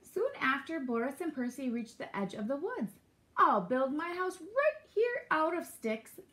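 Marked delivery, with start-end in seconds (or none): 3.4-6.2 high, eager little pig voice; excited piglet announcing a plan; excited squeal. A narrator's reading. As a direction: squeal it with delight, drawn out. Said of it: "Boris squealed excitedly".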